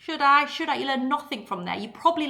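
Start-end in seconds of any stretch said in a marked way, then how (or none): 0.0-0.8 waver timidly, pitched up; dithering hesitation. Acted. Would-be diver on the board's end, mock dithering. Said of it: "standing on the end of the".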